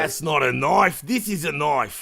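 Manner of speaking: slow, broad Aussie film-hero drawl. Retold or imitated. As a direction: impersonate a broad Australian bushman, drawn out. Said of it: "They talk like redneck Australian".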